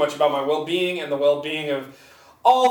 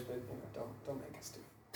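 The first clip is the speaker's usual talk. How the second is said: whispering; 4 semitones lower, 24 dB quieter, faster than usual. Real, not acted. Pleading not to do voices.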